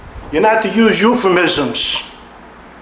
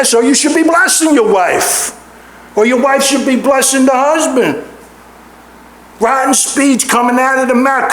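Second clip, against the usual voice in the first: a raised, driving preacher's cry; fervent preaching; 6 semitones higher than usual. Acted. Pastor pressing his flock about foul speech, fervent preaching. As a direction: lift the congregation with rising urgency.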